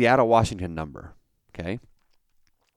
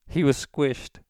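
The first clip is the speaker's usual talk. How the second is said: higher, deadpan he was squished; put-on voice, deadpan; 5 semitones higher, slower than usual. Telling the caller the victim was squished, deadpan in a put-on voice. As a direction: state it primly in the fake voice. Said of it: "as is my custom".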